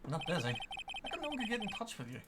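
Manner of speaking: usual speaking voice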